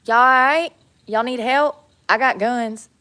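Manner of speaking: loud call across the water. Real, not acted. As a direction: shout it to the birds. Calling out to swans.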